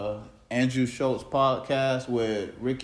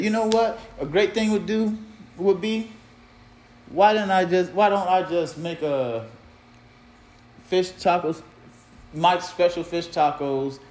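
excited; 5 semitones higher than usual. Retold or imitated. Excited self-quote about idea.

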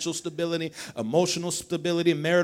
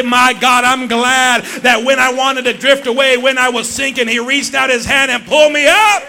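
loud, high preacher's cry; full-force preaching; 8 semitones higher, 16 dB louder than usual. Acted. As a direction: lift the church into praise.

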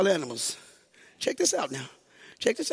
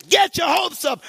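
shouted, higher preaching climax; full-force preaching; 9 semitones higher, 5 dB louder than usual. Acted. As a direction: hammer it home to the congregation.

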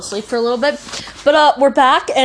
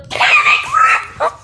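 high, slow blaring song line into the mic; clipping distorted mic; 6 dB louder, much slower than usual. Pretend. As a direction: belt it so loud the mic clips.

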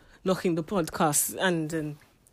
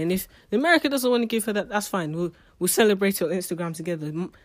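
ordinary delivery

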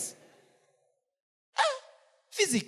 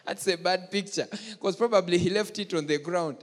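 talking through laughter; laughing; 4 semitones lower than usual. Real, not acted. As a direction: chuckle while saying it. Laughing at his joke.

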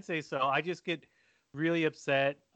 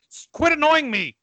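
annoyed snap; 12 semitones higher, 6 dB louder than usual. Retold or imitated. Snapping at teasing wife.

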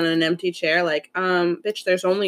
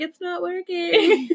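slow, whiny "it's not working" through laughter; laughing mock whine; 9 semitones higher, much slower than usual. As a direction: whimper it out while cracking up. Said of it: "and been like".

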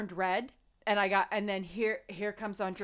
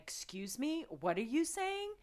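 mock confrontation; 6 semitones higher, 4 dB quieter than usual. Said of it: "being like".

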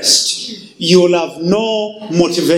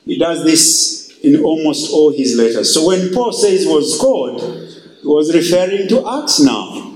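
ordinary talk

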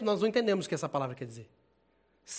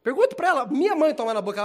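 joking outburst; 6 semitones higher, 8 dB louder than usual. Acted. Joking threat, voice rising loudly.

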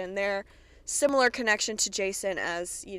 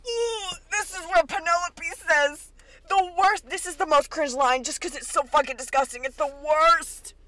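disgusted; 7 semitones higher than usual. Real, not acted. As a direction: recoil at the line. Reacting in disgust.

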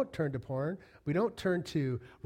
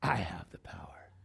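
whisper; 9 semitones lower, 8 dB quieter than usual. Retold or imitated. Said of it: "Raymond is going".

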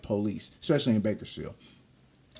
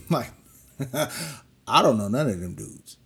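normal talking voice

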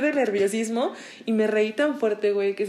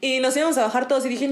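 normal talking voice

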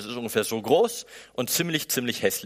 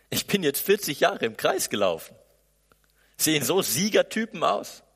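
sarcastic protest; 5 semitones higher, 3 dB louder than usual. Acted. Mose's mocking self-doubt.

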